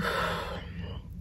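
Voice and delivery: a soft breathy sigh with no voice; whispered sigh. Real, not acted. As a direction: let the breath go out, worn down. Telling of her sadness.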